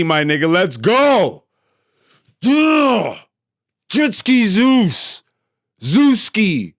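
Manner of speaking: normal talking voice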